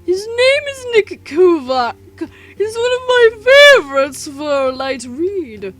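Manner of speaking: bright, excited companion voice; Seth's voice. Acted. Seth introducing his favorite author, eager and high. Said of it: "Seth's voice".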